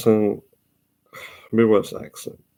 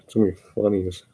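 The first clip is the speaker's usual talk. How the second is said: ordinary talk, nothing marked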